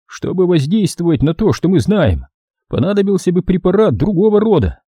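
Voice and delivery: high-pitched explanatory character voice; explaining doctor. A narrator's reading. Doctor explains the pills to Troy, high explanatory voice. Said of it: "пояснил врач".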